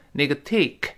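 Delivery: usual speaking voice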